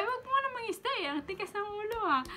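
very high, loud mock scold; shrill playful imitation of a nagging mother. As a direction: squeak it out like a fussing mom.

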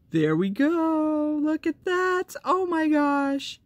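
high, slow gasp of delight; thrilled high-pitched unboxing voice; excited gasp. Real, not acted. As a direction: squeal as the surprise is revealed. Flipping over a surprise gift.

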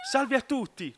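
acted excitement on a greeting to everyone; excited Dende. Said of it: "tutto emozionato Dende". (saluto tutto emozionato)

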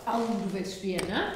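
ordinary delivery

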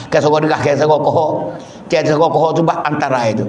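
ordinary talk, nothing marked

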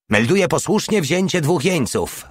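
triumphant call. Acted. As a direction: announce it like a proud victor. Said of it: "wołał triumfalnie Porta". (triumfalnie, dużo wyżej)

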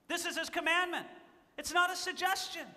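firm preacher's emphasis; emphatic preaching. Real, not acted. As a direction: drive the point home from the pulpit.

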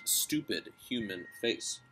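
ordinary delivery, nothing marked

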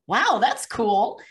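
high, loud, drawn-out wow; amazed. Retold or imitated. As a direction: let it land slowly with awe. Retold amazed reaction to answered prayer.

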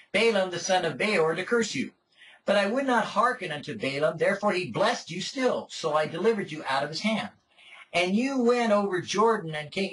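measured, solemn Bible reading voice; formal, unhurried reading; scripture reading. Acted. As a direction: read the passage aloud to the congregation. Reads scripture aloud.

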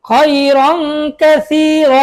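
drawn-out syllables for pupils; slow recital. Shown as a demonstration. Teacher slowly models খয়রন Kathir for pupils. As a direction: stretch খয়রন Kathir very slowly, vowels held. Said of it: "আপনি আমার সাথে বলুন".

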